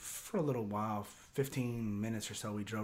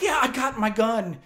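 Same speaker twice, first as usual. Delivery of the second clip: loud, high emphatic reply; heated retelling; 11 semitones higher, 11 dB louder than usual. Retold or imitated. Buddy confirming he is armed, heated. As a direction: act out a worked-up buddy.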